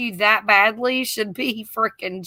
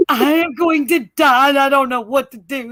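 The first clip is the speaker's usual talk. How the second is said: crying, higher and louder panic; retold crying panic; 3 semitones higher, 4 dB louder than usual. Wife on the phone to husband, crying in panic. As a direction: wail it like you're stranded and scared. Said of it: "I call my husband crying".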